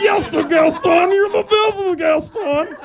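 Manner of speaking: very high, loud squealing flattery; fawning sidekick. Retold or imitated. Sidekick fawning over Gaston, very high and loud. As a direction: squeal praise like a toadying sidekick. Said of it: "He's like".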